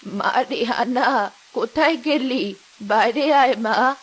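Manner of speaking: put-on low, slow old woman calling; old mother. Old mother calls her daughter Rehana from the yard, low and slow. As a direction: call out like an elderly mother.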